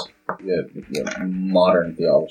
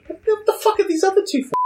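shocked disbelief; much faster than usual. Real, not acted. Shocked reaction to the shopping list.

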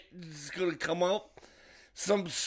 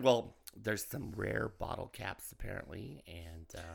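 low mumbling quote; quiet mumble; 7 semitones lower, 9 dB quieter than usual. Retold or imitated. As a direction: mumble it, trailing away. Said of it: "she said".

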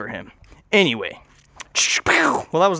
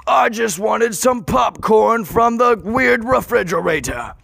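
drawn-out, higher singsong wail; hammed-up complaint; 6 semitones higher, slower than usual. Player whining about a simple wish for popcorn, hammed-up wail. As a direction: wail it slowly and theatrically.

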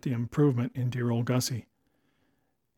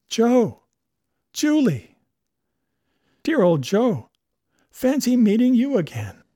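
high, loud delighted exclamations; joyful reunion; 9 semitones higher, 6 dB louder than usual. A narrator's reading. Danby and Julia greet each other, loud joyful cries. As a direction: overflow with glee at seeing an old friend.

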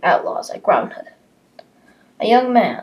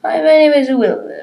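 high servant voice; 10 semitones higher, 9 dB louder than usual. Acted. Servant introducing himself.

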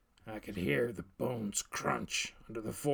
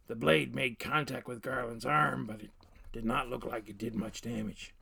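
ordinary delivery, nothing marked

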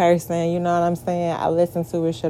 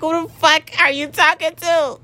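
triggered; 9 semitones higher than usual. Real, not acted. Woman triggered by people talking to her that way, high and exasperated. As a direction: let the exasperation climb high out of a laugh. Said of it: "I'm triggered right now".

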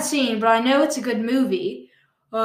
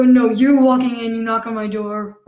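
normal talking voice

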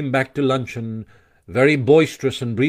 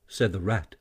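plain reading voice

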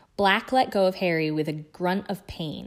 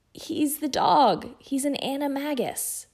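strained warning; 8 semitones higher than usual. Acted. Ron warns Harry urgently.

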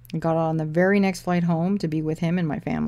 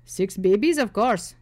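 put-on Indian accent; 4 semitones higher, 4 dB louder, slower than usual. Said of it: "ones with thick accents and head bobbing".

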